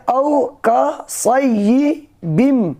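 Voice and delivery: औ कसय्यिबिम slowly, stretched औ; tajweed reading. Shown as a demonstration. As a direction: drag out औ कसय्यिबिम. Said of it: "क्या बोलेंगे?".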